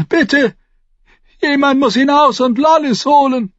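high, loud, desperate begging; screamed plea. A narrator's reading. Mother begging someone to fetch her daughter, screaming. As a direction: plead at screaming pitch. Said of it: "schrie Lady Thunder".

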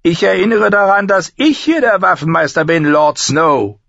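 high, cutting rebuke; sharp, cold authority. A narrator's reading. Master-at-arms reminds Jon who commands, sharp and cold. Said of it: "mit seiner scharfen, kalten Stimme".

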